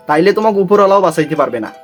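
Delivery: usual speaking voice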